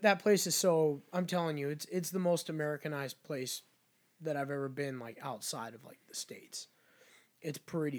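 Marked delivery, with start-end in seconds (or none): 5.0-6.7 unvoiced trailing whisper. Real, not acted. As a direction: drop to a whisper to finish. Trailing off quietly.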